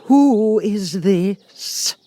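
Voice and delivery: high, slow, imperious Queen voice; severe Queen. A narrator's reading. The Queen eyeing Alice, severe imperious demand. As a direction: demand it down your nose like a haughty queen. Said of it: "the Queen said severely".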